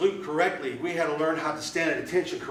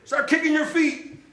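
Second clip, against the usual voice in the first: shouted drill order; 11 semitones higher, 4 dB louder than usual. Retold or imitated. Platoon leader orders recruits on the ground, high shouted command. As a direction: order them around like a mocking drill sergeant. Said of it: "He says".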